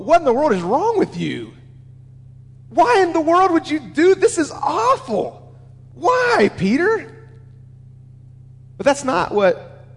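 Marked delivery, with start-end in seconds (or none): 0.0-7.3 high-pitched, hammed-up dismay climbing to "Why"; mock-outraged pleading, pitch leaping up; exasperated outburst. Preacher in Jesus' shoes berating Peter, exasperated. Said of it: "it would go something like this".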